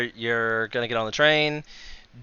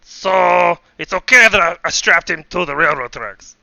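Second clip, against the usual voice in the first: loud, higher villain in bad Russian; slipping Russian villain voice; wobbly Russian accent; 5 semitones higher, 7 dB louder than usual. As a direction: goad them as a mock Russian villain. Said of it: "what's going on with my accent".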